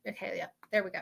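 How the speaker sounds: normal talking voice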